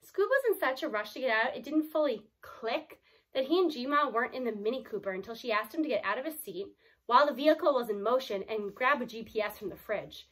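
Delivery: usual speaking voice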